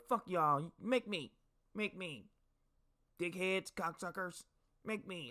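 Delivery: high, thin mocking voice; imitating defiant comrade. Difficult soldier defying the crowd round his bed, mock defiant. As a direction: mimic a stubborn guy cursing in short bursts. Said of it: "that's how he sounded".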